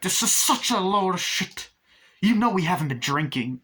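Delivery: angry retort. Retold or imitated. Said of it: "And he's like".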